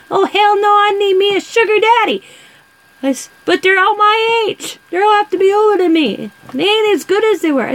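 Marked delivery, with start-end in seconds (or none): 0.1-2.2 high, louder, hammed-up old lady; high cackly granny voice, louder; sassy old lady. Voicing the sassy old aunt. 3.4-7.7 high, louder, hammed-up old lady; high cackly granny voice, louder; sassy old lady. Voicing the sassy old aunt.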